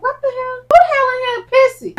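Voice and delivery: high-pitched, appalled outburst; indignant disgust. Retold or imitated. Speaker retells catching a bad smell in the store, high indignant disgust.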